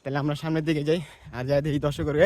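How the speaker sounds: normal talking voice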